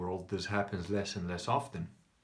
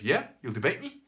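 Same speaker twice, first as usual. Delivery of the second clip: louder, higher mock comeback; retold shouty man; 4 semitones higher, 7 dB louder than usual. Shouty man answering the bald man's challenge, loudly defiant. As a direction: take up the challenge in punchy short bursts. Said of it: "And the other guy goes".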